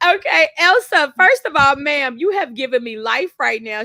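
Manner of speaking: high, loud burst of delight; thrilled. Real, not acted. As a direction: cheer her on, really thrilled. Excited reaction to chat message.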